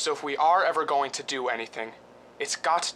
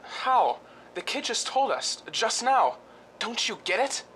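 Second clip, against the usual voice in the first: sudden excitement; 6 semitones higher than usual. Acted. Husband telling Diane he has found the way, excited and higher. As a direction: gasp then higher excited rush.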